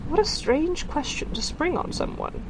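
high, slow, taken-aback voice; slightly shocked. A narrator's reading. Woman asked if she's a witch, slightly shocked. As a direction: draw it out, affronted by the question. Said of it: "looking slightly shocked".

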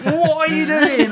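high, loud yelled question; high-pitched mock yell, laughing through it; mock scream. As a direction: shriek it like a startled dresser. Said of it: "screamed and was like".

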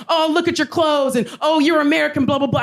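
high, sneering taunt; mocking heckler. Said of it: "he was like".

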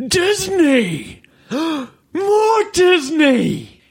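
high, slow gasping squeal of delight; excited fan squeal. Retold or imitated. Retelling a stranger squealing over Disney pins. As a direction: gush over the merchandise like a superfan. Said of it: "and she just goes".

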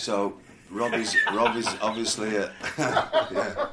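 words broken by laughter; laughing through the words. Real, not acted. Laughing mid-sentence.

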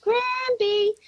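high, thin echoing call; child yelling back. Retold or imitated. Granddaughter answering her grandmother, high yelled reply. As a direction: imitate a child's long high holler. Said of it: "she yelled back".

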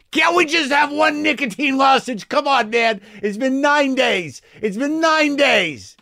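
high, pleading wheedle; whiny craving voice. Acted. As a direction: beg like an addict itching for a fix. Craving voice begging for lozenge.

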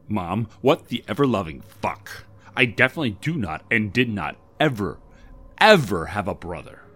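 loud, swearing protest; angry outburst. A narrator's reading. Debbie denies having a brother.

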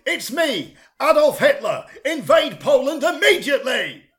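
high, loud shouted command; ranting dictator giving orders; hammed-up tyrant bellowing each phrase; Adolf Hitler. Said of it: "picks up the phone and says".

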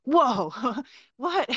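sudden high whoa, laughing into what; startled whoa. Retold or imitated. Kids see the taps burst on, startled high whoa.

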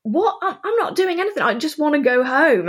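high, incredulous protest; retold disbelief. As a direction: thrown by the accusation, voice jumping high. Incredulous at his accusation.

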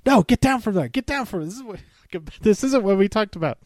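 half-voiced, high mock yelling; Drew Gulak freaking out. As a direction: imitate a frantic coach calling someone down. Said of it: "Drew Gulak starts freaking out".

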